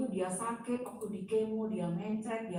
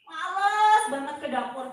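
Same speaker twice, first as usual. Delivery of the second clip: exasperated reluctance; 6 semitones higher, 8 dB louder than usual. Retold or imitated. Depressed woman recalls dreading the kitchen, exasperated reluctance. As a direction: lean hard on the first word, fed up.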